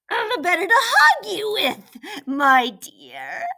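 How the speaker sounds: loud, high wolf in granny disguise. A narrator's reading. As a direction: start high and loud, drop on the endearment. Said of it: "said the wolf".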